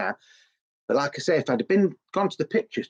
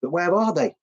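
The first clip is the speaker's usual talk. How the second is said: high, eager question; imitating a girl; 6 semitones higher than usual. Girl on the street asking where the band is, high. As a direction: ask it up high like a young fan. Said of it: "girls and it's going".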